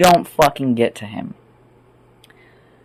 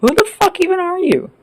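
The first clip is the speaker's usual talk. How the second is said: much higher, mock-baffled question; incredulous dismissal; 12 semitones higher than usual. Answering those who thought we were bothered, high incredulous dismissal. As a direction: pitch it way up, unbothered and scornful. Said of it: "they thought that that meant that we were bothered. We're like".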